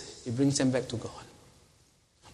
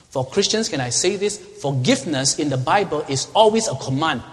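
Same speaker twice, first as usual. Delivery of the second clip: raised, emphatic sermon delivery; forceful preaching; 4 semitones higher, 5 dB louder than usual. Real, not acted. Preacher urging Christians that they must forgive, forceful preaching. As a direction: press the command home with rising fervor.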